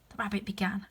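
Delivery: plain reading voice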